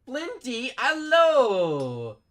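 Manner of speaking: high, loud, long-held hello; whooping hello, voice way up; drawn-out shouted greeting. Real, not acted. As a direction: call out a big sing-out welcome. Greeting a viewer who arrives.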